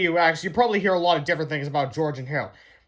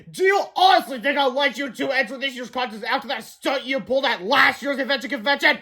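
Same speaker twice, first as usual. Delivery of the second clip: higher, shouting scold; scolding principal; 7 semitones higher than usual. A narrator's reading. Principal scolding the boys, shouting. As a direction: tear into the boys.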